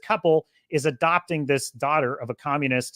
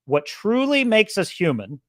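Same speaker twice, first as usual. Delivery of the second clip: ordinary delivery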